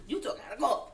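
strained, right after a coughing fit; throat still catching from choking on water. Real, not acted. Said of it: "I was choking on water".

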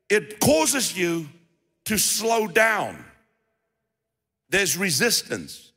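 slow, drawn-out preaching; half-speed, with pauses between phrases; deliberately slow. Real, not acted. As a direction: take it slowly so each word lands. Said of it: "I have to take this slow".

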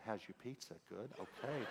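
retold whisper. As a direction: murmur it privately into someone's ear. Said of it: "whispered in her ear".

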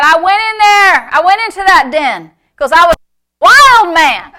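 high, loud, charging delivery; wild-man shout. Retold or imitated. Preacher retells Norval bursting into the den, shouting wildly. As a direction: bellow it as a fired-up retelling.

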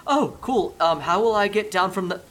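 high, light voice of a rooftop bystander; high NPC voice. Acted. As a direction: pitch it way up, nervous and chipper. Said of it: "and he stands there and he goes".

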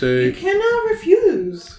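high, slow, loud pronouncement; mock decree. Host overruling co-host's refusal, mock decree. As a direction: declare it slowly, high and loud. Playful argument over refusing.

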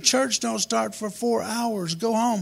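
high, drawn-out protest; exasperated retort. Acted. Woken family sends boy home.

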